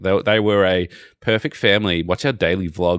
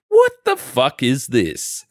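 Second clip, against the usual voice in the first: incredulous disgust; 6 semitones higher, 4 dB louder, much slower than usual. Retold or imitated. Incredulous reaction to vlog couple.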